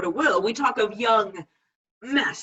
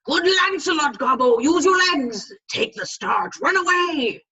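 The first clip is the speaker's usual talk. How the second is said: higher, louder fiend egging him on; urging fiend; 7 semitones higher, 5 dB louder than usual. Retold or imitated. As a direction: push him to flee like a tempter. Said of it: "The fiend is in mine elbow, and tempts me".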